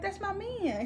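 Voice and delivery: high, softened offhand claim; imitated nonchalance. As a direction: mimic her claiming him offhandedly. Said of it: "not even, like, looking at him".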